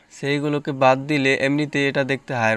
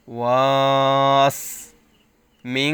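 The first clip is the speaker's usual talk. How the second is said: very slow, louder chant, holding the sin; stretched recitation; 5 dB louder, much slower than usual. Shown as a demonstration. Teacher demonstrating the lengthening, slow chant. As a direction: draw out was and hold the s. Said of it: "এখানে টান দিয়ে এই সিনকে ধরতে হবে".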